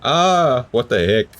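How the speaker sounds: loud, high startled what the heck; shocked outcry. Real, not acted. Seeing everything burned, loud shocked outburst. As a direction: blurt it out in shock.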